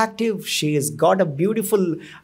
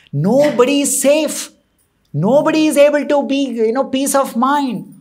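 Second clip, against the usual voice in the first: higher, louder impassioned plea; outraged appeal; 7 semitones higher, 5 dB louder than usual. A narrator's reading. Nalakuvara prays to Brahma about the atrocity, outraged appeal. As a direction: cry out against an intolerable wrong.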